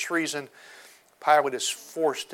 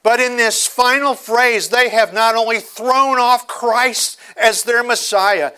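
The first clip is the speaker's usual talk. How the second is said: loud, rising sermon delivery; preaching at full force; 8 semitones higher, 10 dB louder than usual. Acted. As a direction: thunder the story to the congregation.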